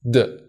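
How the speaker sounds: a voiced d for the ending; d sound. Shown as a demonstration. Teacher modelling the ED ending as d. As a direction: say the ending as d, not id. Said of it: "we pronounce E D at the end of the word as".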